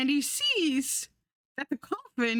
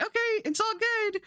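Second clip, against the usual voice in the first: high, strained fake reassurance; forced calm; 11 semitones higher than usual. Nervous driver reassuring himself, high and strained. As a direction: talk yourself down with a high, brittle voice.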